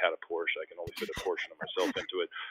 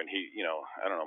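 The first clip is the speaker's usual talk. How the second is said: ordinary delivery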